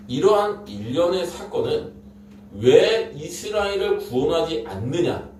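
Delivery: ordinary talk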